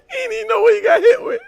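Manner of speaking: high, loud yell through laughter; real laughing yell; laughing shout. Real, not acted. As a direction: scream it out laughing. Laughing hard at the clip.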